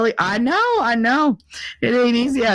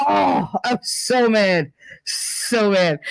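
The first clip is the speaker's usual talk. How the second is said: mad; slower than usual. Real, not acted. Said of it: "I was so mad".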